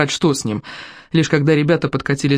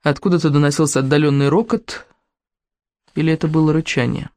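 ordinary delivery

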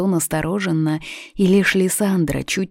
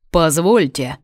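displeased; 5 dB louder, slower than usual. Acted. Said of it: "недовольно начал король".

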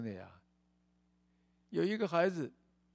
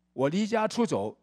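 defiant outburst; 3 semitones higher, 7 dB louder than usual. Retold or imitated. Girl quarrels with mother, runs off.

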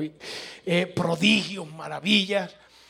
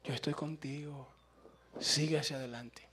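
soft, lowered voice of God; calming; 4 semitones lower, 12 dB quieter than usual. Retold or imitated. God reassuring a furious preacher, calming. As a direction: soothe gently, with unhurried pauses. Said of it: "Dios le habla y le dice, "Cálmate".

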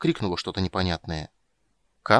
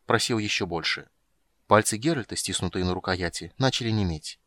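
ordinary delivery, nothing marked

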